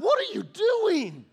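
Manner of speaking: high, loud, slow disbelieving yell with a laugh; half-laughing cry of disbelief; laughing exasperation. Retold or imitated. Parent as kid throws ice cream.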